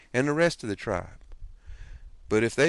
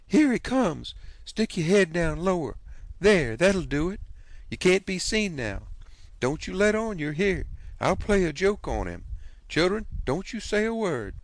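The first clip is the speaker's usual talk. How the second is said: much higher, quick excited aunt's voice; excited scheming aunt; 7 semitones higher than usual. A narrator's reading. Aunt hiding the boy before her husband enters, higher excited voice. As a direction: hurry him out of sight, giddy with the joke.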